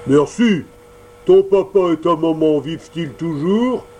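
big puppet master softening a little; gruff Mangefeu. Acted. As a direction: ask with rough bluster. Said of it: "demanda Mangefeu".

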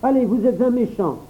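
put-on high, sulky child scolding; a small boy scolding the donkey; hurt little boy. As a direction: reject him like a hurt child.

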